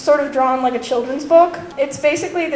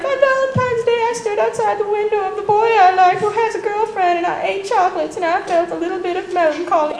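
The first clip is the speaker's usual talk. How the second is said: higher put-on voice of a lovelorn girl; mock melancholy confession; 6 semitones higher than usual. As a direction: confess heartache with hammed-up pathos. Acts another girl's sad love story.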